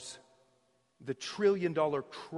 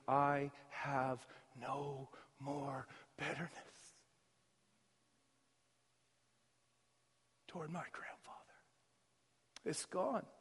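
hushed testimony; 6 dB quieter than usual. Retold or imitated. Woman sharing her healing, hushed and soft. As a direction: say it as a quiet confession of peace.

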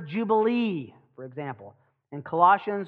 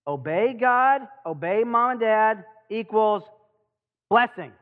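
loud, higher, drawn-out recitation; simple children's lesson; 4 semitones higher, 5 dB louder, slower than usual. Shown as a demonstration. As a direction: recite it slowly and loudly, like a drilled lesson for kids. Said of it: "that simple lesson? Watch this".

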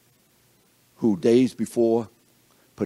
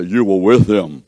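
loud, slow accusation; accusing soldier; 3 semitones lower, 8 dB louder than usual. Acted. Soldier confronting Peter, loud and slow. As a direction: bark the charge at Peter.

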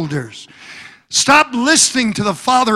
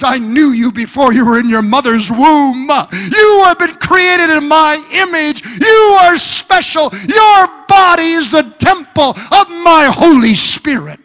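higher, louder, crying preacher's voice; preaching at full force; 6 semitones higher, 5 dB louder than usual. Acted. Preacher speaking God's promise to the church, full-force preaching. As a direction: proclaim it over the congregation.

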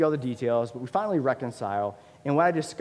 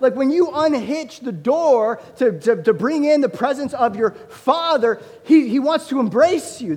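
high, loud, impassioned pulpit cry; full-force preaching; 11 semitones higher, 8 dB louder than usual. Acted. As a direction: lift the room with it, calling them home. Preaching climax.

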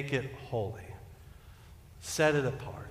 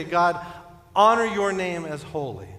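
loud, raised plea to God; fervent prayer; 4 semitones higher, 7 dB louder than usual. Acted. Preacher telling God in prayer, fervent. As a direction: pray it out boldly, voice lifted. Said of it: "we say".

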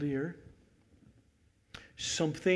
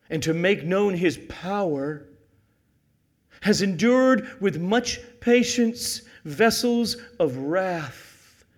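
loud, raised preacher's voice with weighty pauses; forceful scripture reading; 5 semitones higher, 9 dB louder, slower than usual. Real, not acted. Preacher quoting Paul to the congregation, forceful reading. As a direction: read it out slowly and forcefully.

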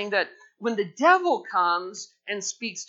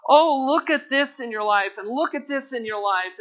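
devil's taunt; 6 semitones higher, 7 dB louder than usual. Retold or imitated. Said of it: "the kind of things that the devil says".

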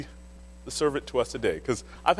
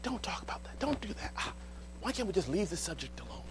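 hushed pleading; 2 semitones higher, 9 dB quieter than usual. Retold or imitated. Inner voice resisting the Spirit.

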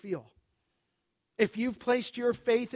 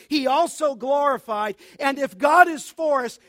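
louder and louder, rising pulpit cadence; preaching at full force; 4 semitones higher, 7 dB louder than usual. Acted. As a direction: build the chain of promises to a peak. Preacher climbs through Romans eight.

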